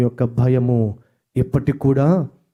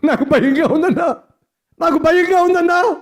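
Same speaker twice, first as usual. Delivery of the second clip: mock frightened plea; 3 dB louder than usual. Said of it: "భయము".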